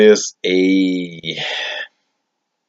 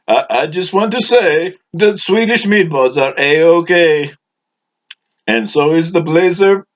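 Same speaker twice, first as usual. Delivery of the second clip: eager audience member; 8 semitones higher than usual. Acted. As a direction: pitch it way up like an eager fan in the crowd. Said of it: "a member of the studio audience wants to".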